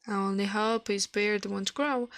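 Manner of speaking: ordinary delivery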